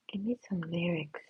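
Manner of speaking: whisper. Shown as a demonstration. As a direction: plead it under the breath.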